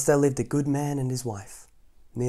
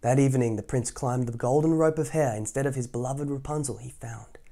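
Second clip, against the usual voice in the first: ordinary delivery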